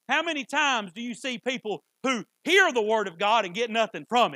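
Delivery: loud, building sermon delivery; fervent preaching. Real, not acted. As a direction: drive each point home to the congregation. Preaching at full force.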